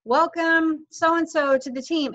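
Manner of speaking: high, slow, cheery welcome; excited welcome. Shown as a demonstration. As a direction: greet the new member with delight. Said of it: "and I say".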